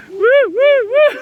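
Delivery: very high, loud woo-woo hoot; high playful whoop. Pretend. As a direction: whoop gleefully in a piercing falsetto. Playful whooping at camping gadgets.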